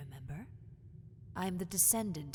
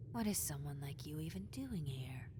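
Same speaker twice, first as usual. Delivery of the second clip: whispered taunt; 3 semitones lower, 5 dB quieter than usual. Acted.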